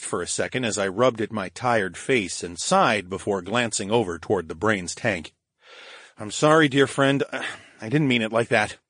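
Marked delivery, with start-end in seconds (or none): none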